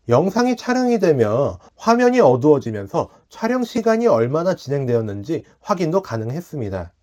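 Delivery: ordinary delivery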